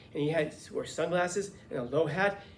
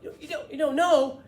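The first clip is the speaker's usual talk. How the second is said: high, loud, stammering disbelief; Andy; 12 semitones higher, 10 dB louder than usual. Retold or imitated. Friend stunned the custodian lacks the time, excited. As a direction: echo it back incredulously, voice shooting up. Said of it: "when he got really excited".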